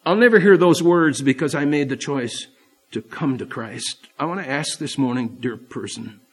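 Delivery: ordinary talk, nothing marked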